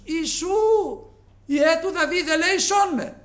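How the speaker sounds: loud, high pleading cry; crying out for mercy. Retold or imitated. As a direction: call out like a blind beggar begging. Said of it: "φώναζε ο τυφλός ο Βαρτίμιος".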